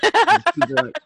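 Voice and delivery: laughing. Pretend. Echoes the joke, laughing.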